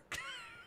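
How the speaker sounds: short high laugh; a brief, real burst of laughter; quick giggle at their own anecdote; amused laugh. Real, not acted. Laughs at own retold reply.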